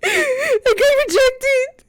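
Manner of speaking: high, slow fake sob; mock crying. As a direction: whine it like a rejected kid. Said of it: "Be like".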